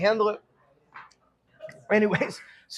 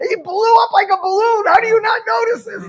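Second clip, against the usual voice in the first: high, loud mock incredulity; exasperated shouting; 10 dB louder than usual. Dad's friend berates parents for not noticing, shouting. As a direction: imitate a man yelling at clueless parents.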